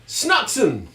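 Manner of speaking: high, exclaimed fake German; mock German. Joking that he can say sneeze in German, mock German. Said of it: "I can say sneeze in German".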